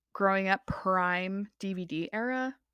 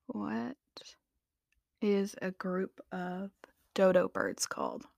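reading a search; 2 semitones lower, 6 dB quieter, slower than usual. Real, not acted. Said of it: "Let me Google this".

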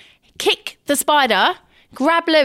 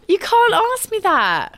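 high protest; 9 semitones higher, slower than usual. Real, not acted. Protesting impossible choice.

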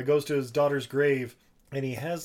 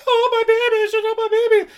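hysterical scream; 12 dB louder than usual. Retold or imitated. Retelling the asylum woman's hysterical screaming, very high and loud. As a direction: howl it out like a mother gone mad. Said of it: "the woman who's like".

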